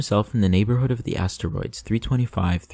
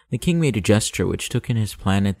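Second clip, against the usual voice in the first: ordinary delivery, nothing marked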